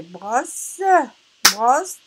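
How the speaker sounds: "brosse" split slowly into bros - se; "brosse" in two separated syllables. Shown as a demonstration. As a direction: sound the final e of "brosse" as its own beat. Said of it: "nous avons ici deux syllabes".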